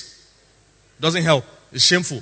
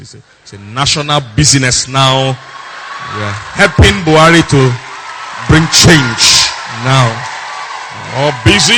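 loud, declaimed pretend busyness; hammed-up loud boasting; mock self-importance; 7 dB louder than usual. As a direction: proclaim it like grand national business. Acts a girl feigning busy importance.

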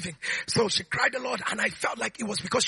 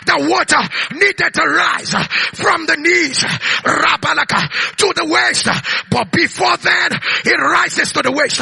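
loud, driving sermon delivery with punched phrase endings; fervent pulpit preaching; full-force preaching; 4 semitones higher, 8 dB louder than usual. Real, not acted.

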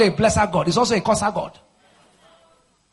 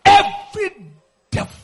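high shouted cries in broken bursts; fierce warfare prayer; 13 semitones higher, 7 dB louder than usual. Real, not acted. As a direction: cry out for divine vengeance, drawing out the key words.

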